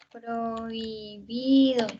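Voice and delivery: "Prohibido" very slowly, oi in one glide; vowels kept together. Shown as a demonstration. Teacher demonstrating unseparated vowels, "Prohibido" slow and gliding. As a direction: draw "Prohibido" out long without breaking oi. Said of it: "no se separan".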